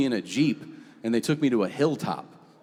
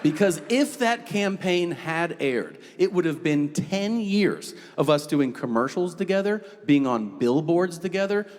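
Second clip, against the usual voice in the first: ordinary talk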